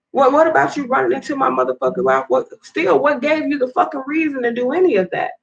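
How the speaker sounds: high-pitched, heated accusation; real fury, voice pushed up high; hurt and outraged, pitch climbing; furious rant. Real, not acted. Confronting the man who hit her.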